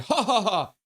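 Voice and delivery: mock laugh for a chat message; read-out laugh. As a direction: read out a typed laugh as separate ha's. Reading out a chatter's written laugh.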